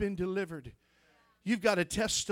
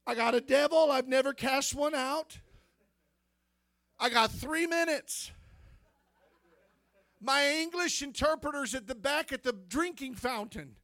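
panicked thought; 5 semitones higher than usual. Retold or imitated. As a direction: relive the panic of an untried preacher. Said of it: "And I thought".

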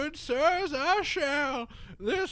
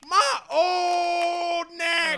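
wailing shout; 3 semitones higher, 8 dB louder, slower than usual. Acted. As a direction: cry out over an aching neck. Old man wailing about pains.

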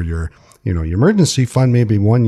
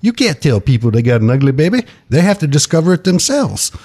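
a hint of Alabama Southern accent; 5 semitones higher than usual. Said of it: "I'm gonna do it in my Southern accent like he does".

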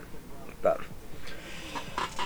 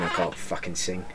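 much softer, low grumbling grump; grumpy man; 3 semitones lower, 10 dB quieter than usual. Retold or imitated. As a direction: mutter it like a miserable bloke. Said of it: "really grumpy, just going".

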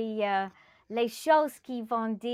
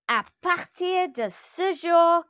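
loud, higher-pitched commanding cry; cri; 6 semitones higher, 7 dB louder than usual. A narrator's reading. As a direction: call it out forcefully, raised voice. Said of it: "cria-t-il". (crié d'une voix forte)

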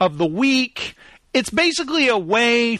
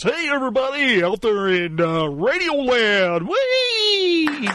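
radio voice; 5 semitones higher than usual. Pretend. Host greeting everybody out in Radio Land, radio voice. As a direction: stretch it out like a goofy radio announcer, swooping up at the end. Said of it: "I gotta do the, uh, the radio voice".

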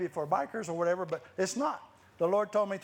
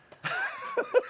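high bursting laugh of joy; delighted laugh. Real, not acted. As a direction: let a happy laugh break out high. Joyful laugh recalling bold act.

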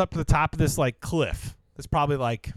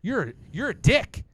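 angry ranting; 6 semitones higher than usual. Retold or imitated. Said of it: "She's like".

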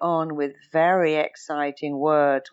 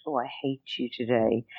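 silly teasing; 5 semitones lower, 5 dB quieter than usual. Retold or imitated. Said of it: "I'm being silly".

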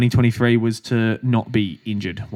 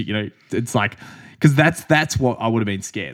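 normal talking voice

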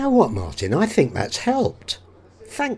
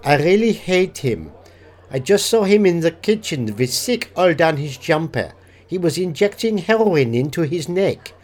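put-on German accent; 2 semitones lower than usual. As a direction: play a German language student. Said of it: "Hans is German".

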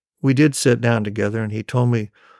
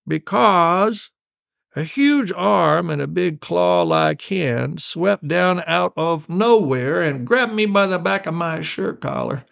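higher, drawn-out storyteller dad, a touch irritated; slightly irritated; 6 semitones higher, slower than usual. Acted. Irritated dad resuming his tale for his child, mildly testy and dramatic. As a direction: stretch it out, mildly exasperated and dramatic. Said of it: "Slightly irritated, he continued".

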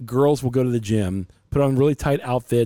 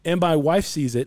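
usual speaking voice

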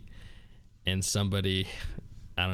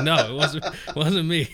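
higher, louder protest in a retold voice; higher-pitched defensive denial, played for laughs; mock denial; 7 semitones higher, 5 dB louder than usual. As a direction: imitate someone protesting innocence. Accused new guy's denial, retold.

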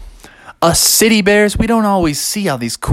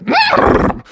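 horse whinny. Acted. Horse noise.

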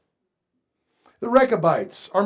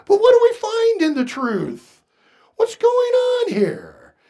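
preaching shout; 6 dB louder than usual. Real, not acted. Preacher's rising challenge.

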